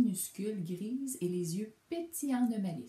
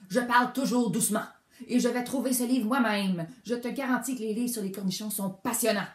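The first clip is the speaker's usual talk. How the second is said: loud, fast, higher angry shout; outright shout; 4 semitones higher, 9 dB louder, faster than usual. A narrator's reading. Bear shouting at the mouse in the library, loud. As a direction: bellow it in a temper. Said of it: "l'ours cria carrément".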